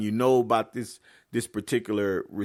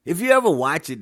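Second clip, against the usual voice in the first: ordinary talk, nothing marked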